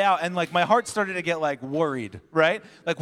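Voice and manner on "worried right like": normal talking voice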